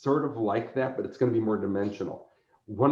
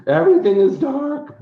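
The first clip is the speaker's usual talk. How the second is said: much higher and louder mock gloomy goth whine; mock emo goth; 8 dB louder than usual. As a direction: ham up the moody teen goth. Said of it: "He's sort of an emo goth who's always like".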